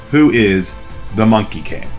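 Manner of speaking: normal talking voice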